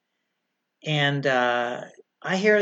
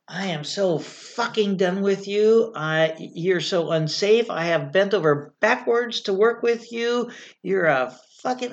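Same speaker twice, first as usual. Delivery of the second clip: higher, emphatic re-enacted telling-off; retold angry blow-up; 5 semitones higher than usual. Firefighter re-enacting blowing up at an unsafe senior captain, angry. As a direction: act out being completely fed up, drawing out the opening words. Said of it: "I dropped the F-bomb on him like Hiroshima".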